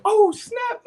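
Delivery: high-pitched, squealing alarm; mock panic. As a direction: play it panicked, voice way up. Acting out fear of approaching man.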